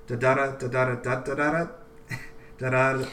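da-da-da in uneven beats, ending with a laugh; scanning the line. Shown as a demonstration. Poet scans her bad second line for her friend, chanting. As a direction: sound out the clumsy rhythm. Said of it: "doesn't have a very good meter".

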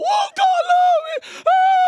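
high, loud, slow whiny mock cry; mock whining. Father mocks daughter's whining, high loud whine. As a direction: whine it like a spoiled child. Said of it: "Stop whining about".

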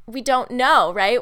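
usual speaking voice

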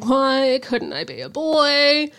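slow, put-on girlish whine; lightly voiced longing child; wistful child. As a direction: sigh it out like a frustrated kid. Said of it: "She's like".

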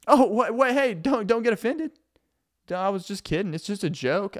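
high, fake-hurt backpedal; mock defensive. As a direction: innocently protest it was a joke. Defensive passive aggressive roommate.

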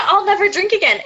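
very high whiny repeat; sorority girl quote. Retold or imitated. Hagrid's line repeated in sorority girl voice, very high whine. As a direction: squeal the line like the meltdown. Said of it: "It's the".

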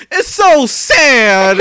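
high, drawn-out wail of a heckler; imitated loud shout. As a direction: shout it out long and high, imitating an audience member.

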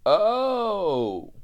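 loud high surprised oh. Retold or imitated. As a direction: realize it suddenly. Customer spots car.

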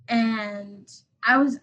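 usual speaking voice

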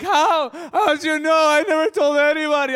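overwhelmed man crying in disbelief. Retold or imitated. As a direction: choke the words out through tears. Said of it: "we're both crying on the ground".

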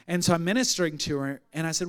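ordinary delivery

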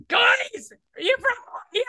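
very high called-out question; hollering across the room, way up; high shout at a stranger in the crowd; mock shout. Repeating the shout bit.

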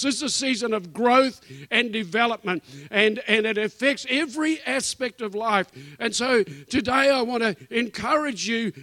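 full-force pulpit preaching, rising to a loud hallelujah. Acted. Pastor urging the church to expect a return, preaching. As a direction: preach it out to the congregation.

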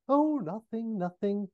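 high, airy backing-off; Austin Powers. Acted. Austin dropping the teasing for a moment, airily innocent. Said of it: "And Austin".

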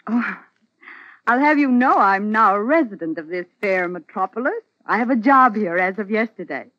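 high, loud, gleeful pride; lilting, excited declaration; playful announcement. Acted. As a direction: announce it with mock grandeur. Woman gaily announces her news.

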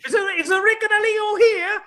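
loud, high fake Italian call-out; fake Italian accent. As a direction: shout across the room like a stage Italian papa. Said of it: "this fakey Italian accent".

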